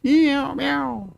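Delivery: high mock meowing; voicing the cat, high-pitched meows; imitating cat meow. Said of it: "said cat".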